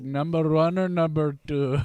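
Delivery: lightly voiced Indian accent; a hint of an Indian attendant. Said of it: "He goes".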